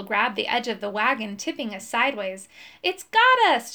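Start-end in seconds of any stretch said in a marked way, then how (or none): 2.8-3.7 high loud panicked shout; alarmed shout. A narrator's reading. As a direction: yell it in alarm. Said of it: "shouted Fireball".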